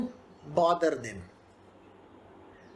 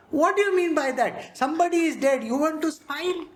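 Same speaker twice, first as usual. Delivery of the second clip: raised, indignant mock scolding; 6 semitones higher than usual. Onlooker scolding a smiling mourner, indignant. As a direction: scold them as a shocked onlooker.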